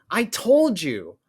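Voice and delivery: high, loud, slow shout. Acted. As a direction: yell it at him, vindicated. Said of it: "as Maddie shouts".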